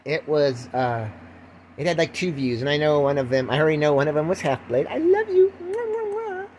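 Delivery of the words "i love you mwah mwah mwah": put-on high baby-sweet voice with kisses; mock gushing affection. Thanking his one known viewer, mock adoring.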